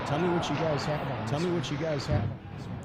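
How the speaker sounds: echoing with heavy reverb. Real, not acted. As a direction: voice bouncing back like an echo. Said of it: "I don't know why that's reverbing".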